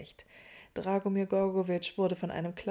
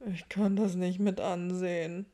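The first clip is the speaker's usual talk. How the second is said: despairing mutter; 4 dB louder than usual. A narrator's reading. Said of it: "murmelte er".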